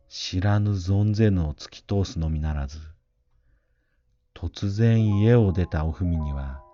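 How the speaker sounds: ordinary delivery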